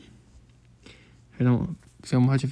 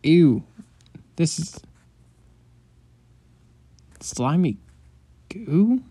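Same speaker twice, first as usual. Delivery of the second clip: disgusted; 4 semitones higher, 4 dB louder, slower than usual. Acted. Slimy goo from brain.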